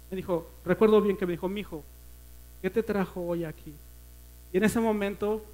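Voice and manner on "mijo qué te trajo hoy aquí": soft, gentle motherly voice; a hushed, caring voice for a lost son; tender question. Retold or imitated. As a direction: ask it softly, like a caring older woman. Tender woman welcoming him.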